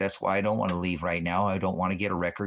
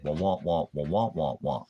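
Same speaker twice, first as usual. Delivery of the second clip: mocking Charlie Brown; 3 semitones lower than usual. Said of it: "because he said".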